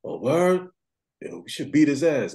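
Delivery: high-pitched acted outrage; mock-alarmed jump in pitch; shocked reaction. Acted shocked reply to warning.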